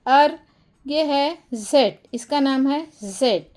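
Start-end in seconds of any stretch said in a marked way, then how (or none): none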